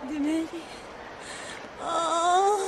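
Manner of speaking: faint, soft moan; groaning in pain. Acted. Injured passenger complaining of the head, weak groaning. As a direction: moan weakly, nursing the hurt.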